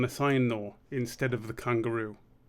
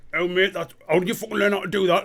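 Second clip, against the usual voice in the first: higher, louder, fast amazed mate; Carl; 6 semitones higher, 6 dB louder, faster than usual. Retold or imitated. Friend asking how he learned it, excited and fast. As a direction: gush it out in disbelief. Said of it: "Carl's like".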